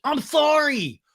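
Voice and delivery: high, whiny imitated outcry; mock exasperated apology. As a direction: blurt it high as a grudging apology. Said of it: "You should still fucking apologize".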